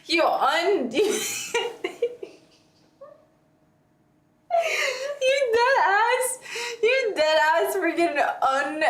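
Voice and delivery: high, cracking with laughter; can't get the words out for laughing; laughing disbelief. Real, not acted. Friend laughing in disbelief at the disposal story, high and cracking.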